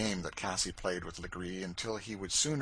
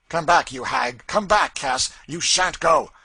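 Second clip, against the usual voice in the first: much louder, higher, frantic calling; shouting after her; 7 semitones higher, 14 dB louder than usual. A narrator's reading. Legree shouts after Cassie fleeing up the stairs, frantic. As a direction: yell up the stairs in alarm.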